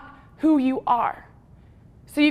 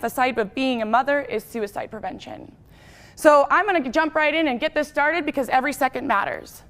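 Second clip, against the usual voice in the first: ordinary talk, nothing marked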